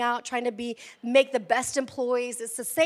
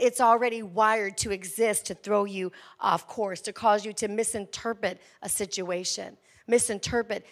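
ordinary talk

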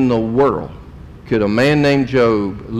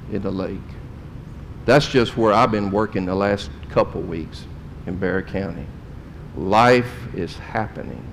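somber, hushed; 4 semitones lower, 7 dB quieter than usual. Real, not acted. Grave list of tragedies.

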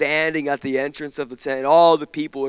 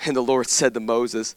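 ordinary talk

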